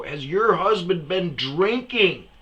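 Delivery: loud, high, drawn-out asking; slow, insistent question. Retold or imitated. Asking a baffled woman about her husband, slow and loud. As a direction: spell it out slowly and loudly.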